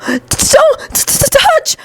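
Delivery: very high-pitched frightened stutter; panicked stammer. Acted. Frightened boy pushing himself away from Shinso, panicked stammer.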